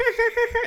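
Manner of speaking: high cackle after the line; high witchy laugh; witch cackle. Acted. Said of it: "more like a witch".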